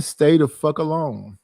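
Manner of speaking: ordinary talk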